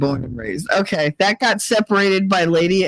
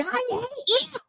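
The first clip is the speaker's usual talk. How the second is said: high and much softer, giggling it out; a mock hurt line swallowed by laughter; laughing; 7 dB quieter than usual. Real, not acted. Quoting Kate after the prank, laughing. Retelling Kate's reaction.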